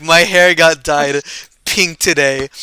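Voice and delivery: loud, drawn-out announcement; slow loud confession. Retold or imitated. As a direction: spell it out slowly and loudly. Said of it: "I'm like".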